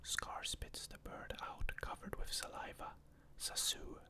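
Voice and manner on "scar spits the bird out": usual speaking voice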